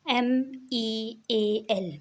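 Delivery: very slow letter-by-letter spelling; slow spelled letters. Shown as a demonstration. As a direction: dictate each letter slowly. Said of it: "अगला देखिए मील". (अक्षर धीरे-धीरे)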